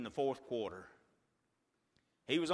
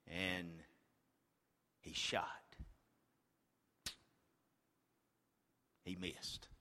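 whispered, much softer play-by-play; hushed suspense; 11 semitones lower, 12 dB quieter than usual. Acted. Preacher hushing the crowd before the shot, whispered. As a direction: hold your breath and whisper it. Free throw suspense in story.

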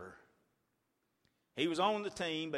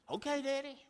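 lightly voiced small boy, softer; son's reply; 9 semitones higher, 3 dB quieter than usual. A hint of a young son answering his dad. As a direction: answer like an obedient kid.

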